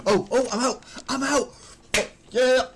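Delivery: relieved shout. Real, not acted. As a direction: cheer at escaping the accent. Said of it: "I'm out of the accent".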